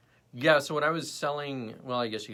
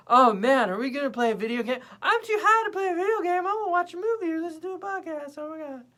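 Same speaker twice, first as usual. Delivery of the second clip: high, loud, floaty giddy voice; giddy stoned voice; 7 dB louder than usual. Retold or imitated. Imitating herself high on a brownie in a giddy voice. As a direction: play yourself too high to game. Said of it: "It's like".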